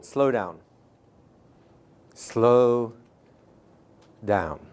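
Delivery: slow, drawn-out words with long gaps; slowed right down. Shown as a demonstration. Trainer modelling how to slow a client, very slowly. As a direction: let each word land, then pause. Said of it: "This is probably the most important one".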